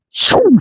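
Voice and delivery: sharp high whoosh of an arrow; a quick high swish for the arrow; mock arrow sound effect. Imitating a flying arrow.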